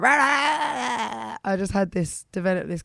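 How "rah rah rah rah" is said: slow, high, rough mock belting as a kid; hammed-up imitation of a kid roaring songs; imitating kid belting. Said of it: "I think 'cause I was just like".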